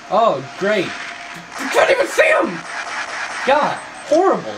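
high, strained shout of frustration; exasperated yell. Real, not acted. Gamer unable to see the enemy, exasperated yell. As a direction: cry out in disbelief at the game.

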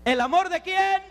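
high, rising prompt to a crowd; rousing call-out. Real, not acted. Preacher can't hear the congregation's answer, rousing call-out. No escucho.

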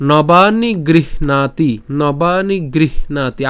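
very slow গৃহণাতি, "h" before "n"; h sounded first. Shown as a demonstration. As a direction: breathe the "h" in "grih", then "naati". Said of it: "আগে হ উচ্চারণ হবে". (গৃহণাতি, আগে হ, ধীরে টেনে)

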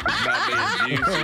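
laughing, fast and high. Real, not acted. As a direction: giggle the words out quickly. Giggling at the drama.

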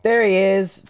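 usual speaking voice

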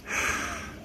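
unvoiced exhale before talking; audible breath out. Shown as a demonstration. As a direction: sigh the air out softly, voicelessly. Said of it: "breathing out before I talk".

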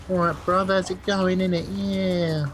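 chirpy Essex geezer accent. Shown as a demonstration. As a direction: do a cheeky Essex lad accent. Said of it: "and then I sound like".

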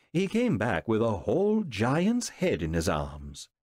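ordinary delivery

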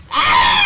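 a sudden high, loud scream; shrieking gamer cry; real scream. Real, not acted. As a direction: scream out in shock. Sudden scream mid-game.

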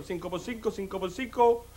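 low, hushed working-out; great-grandfather pondering. Acted. Condemned ancestor works out the executioner's sum, pondering softly. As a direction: repeat the sum softly to yourself. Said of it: "dice mi tatarabuelo".

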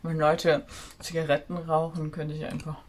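talking while chewing gum. Real, not acted. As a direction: chew gum between the words. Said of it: "Erstmal kaue ich mal ein bisschen Kaugummi". (mit Kaugummi im Mund)

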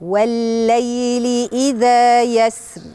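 slow, drawn-out tajweed recitation; Quran recitation. Shown as a demonstration. Teacher reciting Surat al-Fajr slowly for the children. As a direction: stretch each verse out for the class to repeat.

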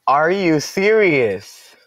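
can't believe what just happened; stunned disbelief. Real, not acted. Opponent's shocking move.

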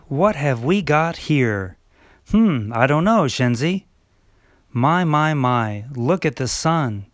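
ordinary talk

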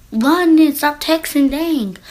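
loud, high frustrated order; exasperated yell. Real, not acted. Viewer yells at the girl to stop texting and run. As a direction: bark at her to run. Yelling advice at the story.

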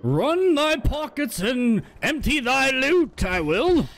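high, slow, drawn-out theatrical demand; mock-archaic robber. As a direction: stretch it out high like a hammed-up old-time bandit. Mock-archaic demand during game.

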